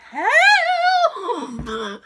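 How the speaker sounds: long drawn-out, high, loud wail; comically desperate, drawn-out call; hammed-up cry for help. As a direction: stretch the mock plea out, breaking into laughter. Acts out second, bigger call.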